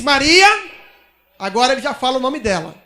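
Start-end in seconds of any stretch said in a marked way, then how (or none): 0.0-0.5 louder call with a rising end; calling her name. Acted. Jesus calls her name. (Jesus chamando Maria pelo nome, em voz alta)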